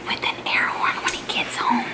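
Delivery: hushed unvoiced whisper; whisper yelling. Real, not acted. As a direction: whisper so he won't hear. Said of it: "I'm over here whispering".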